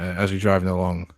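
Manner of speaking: usual speaking voice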